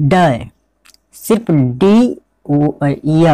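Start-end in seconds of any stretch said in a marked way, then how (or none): 1.1-2.2 सिर्फ डी spelled out slowly; slow letter demo. Shown as a demonstration. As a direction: draw out डी as a letter sound. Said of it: "उस स्थिति में". (धीमे से अक्षर डी)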